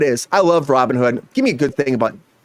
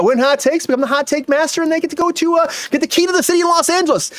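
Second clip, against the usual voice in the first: excited host; 11 semitones higher than usual. Acted. Host hyping the prize.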